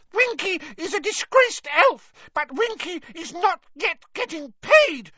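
very high, loud squeaky elf outrage; furious squeak. A narrator's reading. Winky answers Hermione about pay, furious high squeak. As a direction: squeak it in wounded fury. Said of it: "she squeaked".

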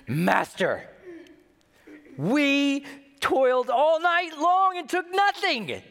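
high, slow, whining complaint; weary complaining Peter. Retold or imitated. Peter complaining to Jesus after a long night, whiny. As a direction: drag it out like an exhausted fisherman.

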